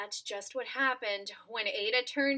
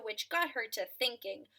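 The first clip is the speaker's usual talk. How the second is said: usual speaking voice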